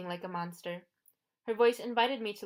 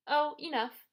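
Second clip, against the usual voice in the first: put-on higher, breezy old woman brushing it off; lightly voiced old lady; 7 semitones higher, 5 dB louder than usual. A narrator's reading. Auntie Em brushing off Percy's doubt, light and sweet. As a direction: wave the worry away sweetly.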